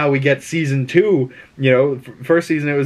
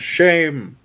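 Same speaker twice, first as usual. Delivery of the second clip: imitating Septa Unella; 4 semitones higher than usual. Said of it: "made her say shame a whole bunch".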